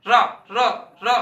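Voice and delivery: रा said loud with the tongue tip curled back; demonstrating ra sound. Shown as a demonstration. Teacher sounding out रा for the class. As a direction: sound out रा crisply. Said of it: "अब देखिए रा को भी समझते हैं".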